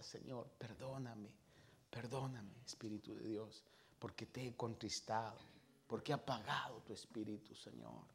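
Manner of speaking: hushed prayer. Retold or imitated. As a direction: confess it almost in a breath. Retold quiet prayer of repentance.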